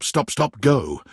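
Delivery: ordinary delivery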